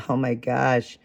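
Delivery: ordinary delivery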